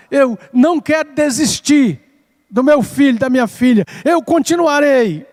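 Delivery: parent's plea to God, pitched high; acted fervent prayer. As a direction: plead with rising resolve, as if praying on your knees. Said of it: "nós vamos nos ajoelhar e dizer". (súplica fervorosa encenada, em tom elevado)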